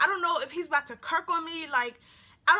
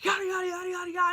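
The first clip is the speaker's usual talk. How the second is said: imitated rant; 4 semitones higher, faster than usual. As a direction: babble mockingly like someone going off. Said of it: "going off".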